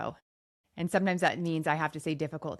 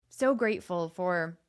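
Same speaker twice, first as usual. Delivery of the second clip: ordinary talk, nothing marked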